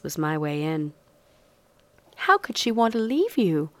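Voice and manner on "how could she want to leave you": high, sweet mock pity; fake sympathy. A narrator's reading. Feigned sympathy, seducing Harris.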